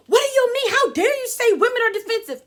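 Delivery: high, loud, outraged cut-in; indignant outburst. Retold or imitated. Woman cutting the man off, loud outrage. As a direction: snap back in mock offence. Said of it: "She immediately cut right in there and said".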